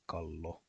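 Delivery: kallo with a held double l; kallo, pitkä l; long Finnish l. Shown as a demonstration. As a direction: linger on the ll in kallo. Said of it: "pronounced as a long L sound".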